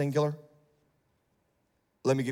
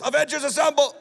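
hero rally cry; 9 semitones higher, 4 dB louder than usual. Acted.

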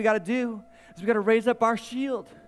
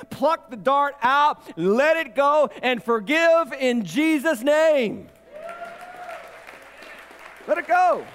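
preaching shout; 5 semitones higher, slower than usual. Real, not acted. Preacher urging his church to forgive, a full shout. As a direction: belt it out like a revival preacher.

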